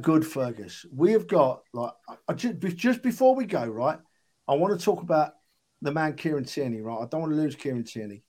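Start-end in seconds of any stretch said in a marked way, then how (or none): none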